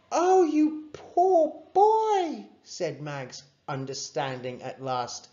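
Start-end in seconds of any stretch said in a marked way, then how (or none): 0.1-2.5 very high, loud, drawn-out sympathy; high, slow cry of sympathy; pitying window cleaner. A narrator's reading. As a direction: gush with pity. Window cleaner pities sick boy.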